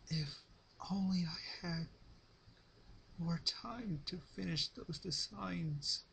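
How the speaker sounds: high, soft, slow fading regret; Rarity's wistful last thought. Acted. Dying Rarity's final thought about her designs, soft and wistful. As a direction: let it drift away wistfully.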